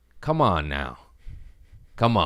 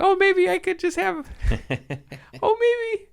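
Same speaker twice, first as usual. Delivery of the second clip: much higher, squeaky self-mocking whine; hammed-up high wheedling bargaining with yourself; mock hopeful whine. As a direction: play your own wishful inner voice, pleading and silly. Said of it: "I was like".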